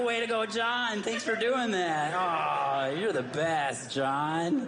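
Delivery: high, gushing praise for John; mock gushing thanks. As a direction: cheer John on like a proud fan.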